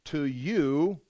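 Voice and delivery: "To you" drawn out with weight; emphatic. Shown as a demonstration. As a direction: put clear weight on "To you". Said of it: "this is emphatic".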